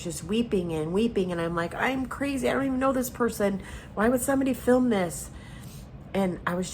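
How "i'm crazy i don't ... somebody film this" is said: higher-pitched, distraught self-quote; retold upset outburst. Woman weeping over a stranger's video, retold upset. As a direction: replay being worked up, pitch raised.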